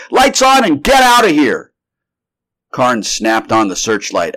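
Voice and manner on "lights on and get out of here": high, loud shouted order; frantic command at full voice; urgent cry. A narrator's reading. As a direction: yell for an immediate escape. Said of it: "he cried suddenly".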